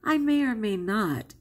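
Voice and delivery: ordinary talk, nothing marked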